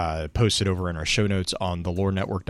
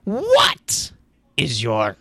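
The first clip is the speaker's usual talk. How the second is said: very high squeaky voice; Monty Python bridgekeeper; 6 semitones higher, 4 dB louder, much slower than usual. Retold or imitated. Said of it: "just do the Monty Python".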